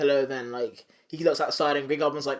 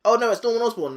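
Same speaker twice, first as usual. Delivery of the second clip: higher, alarmed Norman side of a back-and-forth; mock panic, pitched up; alternating persona; 7 semitones higher, 4 dB louder, faster than usual. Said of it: "back and forth".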